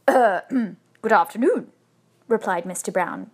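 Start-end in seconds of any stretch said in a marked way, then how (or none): 0.1-1.7 hesitant reply after clearing the throat; louder, unsure and stalling greeting; doubtful. A narrator's reading. Husband answering a talking bear, doubtfully.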